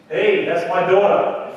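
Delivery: slow, loud protective snap at the joke; angry father. Retold or imitated. Father overhears joke about bride.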